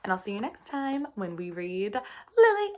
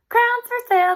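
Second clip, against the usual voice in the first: much higher, louder street call; yelling; 14 semitones higher, 13 dB louder than usual. Acted. Girls yelling to stop people on the street, loud and high. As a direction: call it out to passersby. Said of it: "they yelled".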